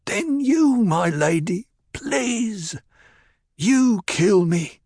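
high, slow, desperate plea; a broken man pleading for death; forlorn pleading. Acted. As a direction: beg her in anguish, voice cracking upward. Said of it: "spoke in a forlorn, pleading note".

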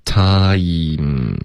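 "Time" drawn out from ah through ee to m; slow glide. Shown as a demonstration. Teacher modelling for the host to repeat, drawn out. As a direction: stretch "Time" through each sound. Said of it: "要慢慢地练".

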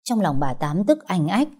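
ordinary delivery, nothing marked

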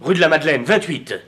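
loud, high, cutting; angry triumph. Acted. Jealous lover throwing the rival's address at Clotilde, loudly. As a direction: snap the address out like proof.

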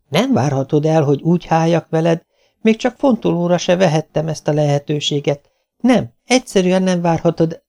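upset wife standing her ground; protesting wife. Acted. Narrator voices the wife refusing.

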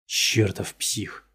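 slow hissed curse through teeth; through gritted teeth. A narrator's reading. Detective cursing the suspect under his breath, slow and seething. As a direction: spit it out slowly through clenched teeth. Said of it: "процедил он сквозь зубы".

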